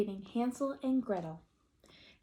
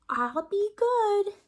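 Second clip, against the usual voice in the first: high, loud, slow promise; drawn-out eager promise; earnest chick promise; 11 semitones higher, 6 dB louder, slower than usual. Acted. Little chicken promising Papa to behave, high earnest voice.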